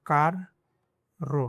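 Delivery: कार then रो with a break for a rolled rr; divided syllables. Shown as a demonstration. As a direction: split कार रो in two. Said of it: "इसको divide करके पढ़ना है". ("कार रो" दो हिस्सों में तोड़कर)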